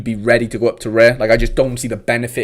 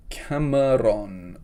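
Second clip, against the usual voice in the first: drawn-out name; 6 dB quieter, much slower than usual. Pretend. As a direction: stretch the name out slowly, musing. Reading out the next name.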